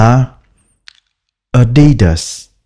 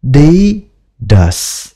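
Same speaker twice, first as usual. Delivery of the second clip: slow; 3 semitones higher, much slower than usual. Shown as a demonstration. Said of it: "nói cái kiểu gì cũng được".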